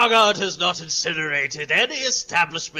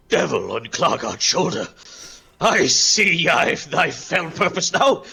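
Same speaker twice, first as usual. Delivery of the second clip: Klargard, grandly suspicious; 6 semitones higher, 4 dB louder than usual. Acted. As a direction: proclaim it like an offended warrior. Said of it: "Klargard looks at you and goes".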